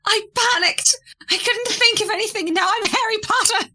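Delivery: high squeaky mimicry; mocking Hugh. Flopsy mocking Hugh's apology in a high squeak. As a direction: parrot his excuse in a high squeaky voice.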